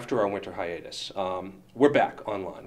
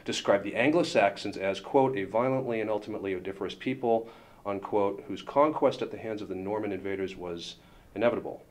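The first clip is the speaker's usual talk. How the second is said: formal letter reading. Acted. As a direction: read it out like a stiff legal notice. Reading a lawyers' letter aloud.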